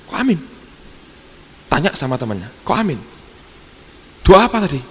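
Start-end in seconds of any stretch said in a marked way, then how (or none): 0.1-0.4 high startled disbelief; shocked. Retold or imitated. Guest stunned the prayer ended, high shocked question. 2.6-4.8 higher, louder demanding question; indignant. Retold or imitated. Guest asking a friend what the prayer was, rising anger. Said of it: "Ngamuk, saudara".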